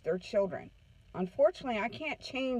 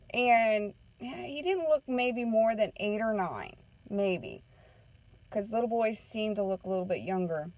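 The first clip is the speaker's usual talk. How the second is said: ordinary delivery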